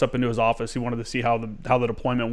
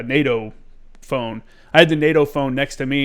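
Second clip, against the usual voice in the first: normal talking voice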